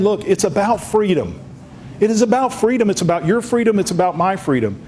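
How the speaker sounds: fervent repeated appeal to freedom; rising passion. Real, not acted. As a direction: build the plea up to a peak and ease off. Building plea about freedom.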